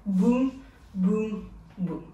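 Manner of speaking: BOUM, BOUM, BOUM slow and separated; marked-out beats. Shown as a demonstration. Dance teacher marks each hit with the hand, slow spaced BOUM. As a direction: leave a clear gap after each boom. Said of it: "on va marquer avec notre main".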